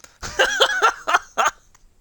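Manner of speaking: very high, loud cackle; high-pitched burst of real laughter. Real, not acted. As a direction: shriek with sudden laughter. Laughs at the child tripping.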